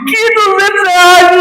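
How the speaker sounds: laughing in disbelief, high and slow. Real, not acted. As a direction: laugh it off, loud. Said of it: "laughing at the cinema price". (rindo incrédulo, alto e arrastado)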